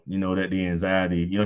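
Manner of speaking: usual speaking voice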